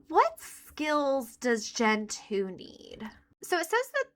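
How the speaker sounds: whisper. Real, not acted. Whispering aside while reading.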